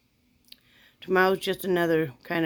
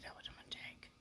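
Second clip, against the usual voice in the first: whispered confession; 27 dB quieter than usual. Real, not acted. Vlogger admitting the strawberries to viewers, whispered. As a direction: whisper it like a guilty secret.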